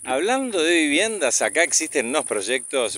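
usual speaking voice

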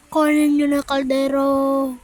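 loud call across the room; loud slow call. Real, not acted. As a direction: call for the pot, stretching kaldero. Calling for the pot.

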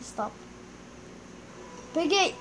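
ordinary delivery

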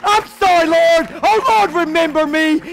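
high, full-voiced cry to God; impassioned preacher shouting a sinner's prayer; desperate shouted plea. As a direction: beg for forgiveness at the top of your voice.

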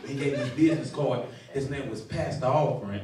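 ordinary delivery

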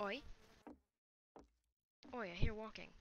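put-on slipping into a light Australian accent. Player hears walking in the game, light Australian accent. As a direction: say it like a mock Aussie. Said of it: "I turned Australian for some reason".

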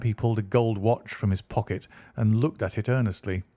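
plain reading voice

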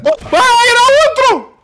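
very high, loud alarm; panicked shout. Pretend. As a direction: scream that it got in. Monster has got inside, panic.

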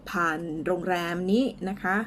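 usual speaking voice

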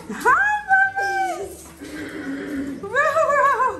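very high, sing-song hi. Real, not acted. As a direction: squeal hello to the puppies. Greeting the puppies excitedly.

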